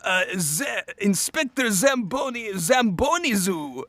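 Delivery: high, slow, hammed-up French accent; French-accented cartoon villain explaining himself. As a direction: put on your best over-the-top French accent. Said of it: "calling upon your best French accent right now".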